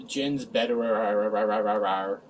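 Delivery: very slow, drawn out into a low blah-blah warble; dismissive droning. Real, not acted. Reviewer summing up tedious bickering, dismissive drone. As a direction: let the words smear into a bored drone.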